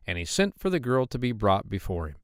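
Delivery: ordinary delivery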